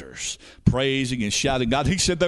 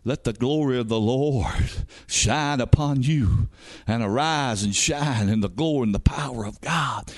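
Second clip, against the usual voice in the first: usual speaking voice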